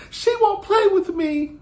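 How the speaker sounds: put-on high, slow whine of a little girl; whiny child voice. The little niece whining to her aunt, high and slow.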